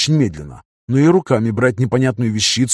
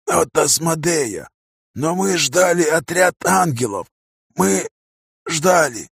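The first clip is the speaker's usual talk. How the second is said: higher, slow, strained croak; dying angel voice; 5 semitones higher, slower than usual. A narrator's reading. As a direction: force each word out, fading. Умирающий ангел отвечает. (хрипящий умирающий голос)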